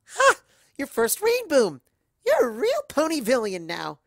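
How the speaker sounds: high, loud and excited; gleeful cheer. Acted. Pinky welcoming the narrator, loud and gleeful. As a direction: celebrate him.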